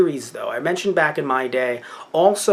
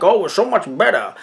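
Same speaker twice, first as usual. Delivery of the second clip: hammed-up superior sneer, louder and higher; exaggerated snooty put-on; mock condescending; 5 semitones higher, 5 dB louder than usual. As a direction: play at lording it over them, sing-song swoops. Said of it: "not in any way trying to be condescending".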